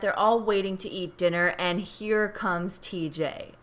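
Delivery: ordinary delivery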